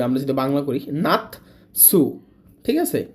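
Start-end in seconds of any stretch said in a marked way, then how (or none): 0.9-2.2 নাতসু drawn out slowly, তসু said clearly; slow Japanese word. Shown as a demonstration. Teacher gives example word to learners, slow and clear. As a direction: say নাতসু slowly, stressing the tsu. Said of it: "এই যে না খণ্ডের তরে ঢুকবে".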